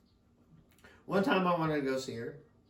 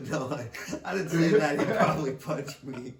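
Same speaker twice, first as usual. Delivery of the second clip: ordinary talk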